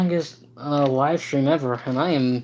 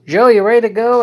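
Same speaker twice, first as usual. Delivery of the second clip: louder, higher call to a toy train; playful calling out; 7 semitones higher, 9 dB louder than usual. Pretend. Host to toy train Joe about leaving, playfully calling out. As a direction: call out as if rousing someone.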